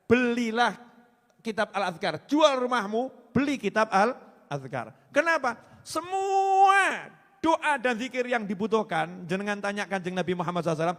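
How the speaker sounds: rousing sermon call to buy the book; fiery preaching. Acted. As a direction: preach it at full force, urging the crowd. Urging listeners to buy book.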